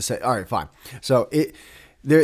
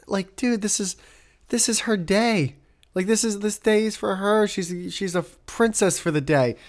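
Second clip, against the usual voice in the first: indignant sympathy; 8 semitones higher than usual. Real, not acted. Upset on bride's behalf.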